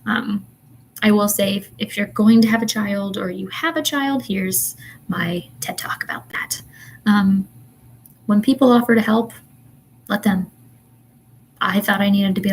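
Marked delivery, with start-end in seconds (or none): 4.2-6.6 slow, hushed joking whisper; whispered aside. Real, not acted. To viewers mid-rant, a slow joking whisper. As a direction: let it slip out like a secret.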